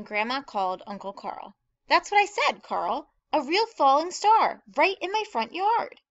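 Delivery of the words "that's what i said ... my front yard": high, loud, thrilled storybook voice; excited news-telling. A narrator's reading. Grandma phoning Uncle Carl about the star, loudly excited. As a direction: gush the big news, pitched high.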